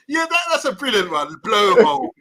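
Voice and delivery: high, amused after a laughing fit. Real, not acted. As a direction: savour the funny word through laughter. Still laughing at the word blowhole.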